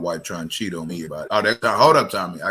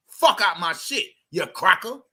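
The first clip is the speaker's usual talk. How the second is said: angry dismissal; 10 semitones higher, 3 dB louder than usual. Real, not acted. Host throwing caller off stream.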